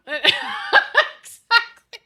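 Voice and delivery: high, slow word through laughter; laughing exactly. Real, not acted. Streamer laughing in agreement with chat, laughing.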